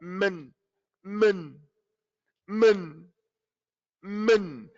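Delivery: "min" going straight from m to n; no fatha. Shown as a demonstration. Teacher shows what she's not gonna say, mispronounced. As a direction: say "min" with the mouth unopened, vowel squeezed out. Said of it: "meme to the noon with no fatha".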